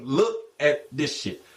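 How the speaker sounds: slow, drawn-out mock voice repeated; imitating Earl. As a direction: drag it out like him again. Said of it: "He's repeating him".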